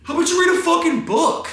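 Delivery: high-pitched yell from a stranger; high, strained holler; heckler's yell. Retold or imitated. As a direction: yell it across the street like an angry passerby. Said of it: "I hear somebody yell".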